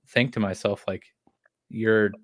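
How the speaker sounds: usual speaking voice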